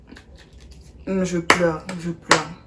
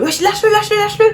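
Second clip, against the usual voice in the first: mock cousins yelling; 14 semitones higher, 9 dB louder, faster than usual. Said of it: "ils sont là".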